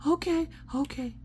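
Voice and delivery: put-on high, soft kid voice; child giving in. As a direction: imitate a meek little kid agreeing.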